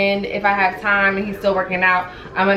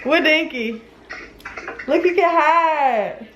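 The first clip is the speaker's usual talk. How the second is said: high sing-song coo; 6 semitones higher, slower than usual. Real, not acted. Cooing to the puppy.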